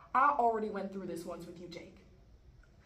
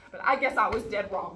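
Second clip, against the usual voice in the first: loud, bitter admission; bitter outburst; 11 dB louder than usual. Acted. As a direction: throw it out loudly, fed up. Repeats it angrily, giving up.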